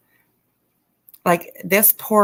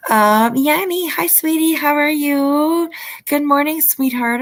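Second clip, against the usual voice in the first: sweet greeting; 7 semitones higher than usual. Real, not acted.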